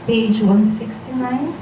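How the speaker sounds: ordinary talk, nothing marked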